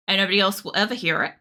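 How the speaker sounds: ordinary talk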